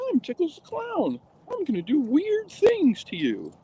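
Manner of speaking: very high, strange creepy clown voice. Retold or imitated. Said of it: "sounds like a creepy clown".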